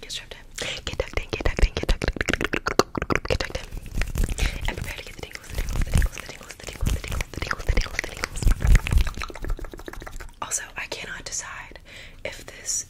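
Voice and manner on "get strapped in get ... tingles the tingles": rapid close-mic whispered trigger words; fast aggressive asmr. Acted. ASMR intro, repeated trigger words.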